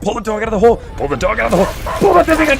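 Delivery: loud, high desperate screams; screaming. Real, not acted. Streamer screams as the dog is pulled into the hole, desperate yelling.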